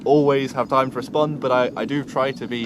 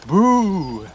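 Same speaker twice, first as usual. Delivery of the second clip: sudden high loud jump-scare shout; playful boo; 7 semitones higher, 6 dB louder than usual. Pretend. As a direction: pop out with a cheeky boo. Jokey face reveal scare.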